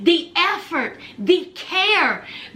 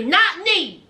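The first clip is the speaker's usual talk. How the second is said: usual speaking voice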